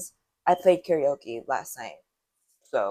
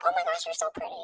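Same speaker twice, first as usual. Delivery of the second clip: much higher sugary mock voice; mock fake-nice girl; 4 dB quieter, faster than usual. Imitating girls lying to her in a high gushing voice. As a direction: gush in a high falsetto. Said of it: "They're like".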